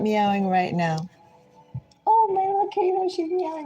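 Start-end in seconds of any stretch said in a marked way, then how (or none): 2.1-3.6 high cooing voice for the kitty. Real, not acted. Owner cooing to her little cat. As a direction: coo up high like talking to a pet.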